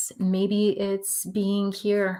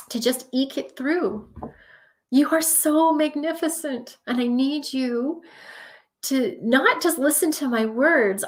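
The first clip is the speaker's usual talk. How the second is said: choked up, higher-pitched and earnest; emotional; 5 semitones higher than usual. Real, not acted. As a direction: plead with them, moved almost to tears. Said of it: "Getting all emotional".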